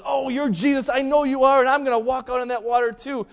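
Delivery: loud, high, bold burst; eager confidence. Retold or imitated. Peter calls to Jesus walking on water, eagerly confident. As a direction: blurt it out with hammed-up eagerness.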